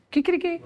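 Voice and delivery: high mock rooster crow, Kikiriki. Asking how Spanish roosters crow, high Kikiriki. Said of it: "¿Aquí cómo hacen en España?".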